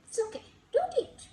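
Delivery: ordinary delivery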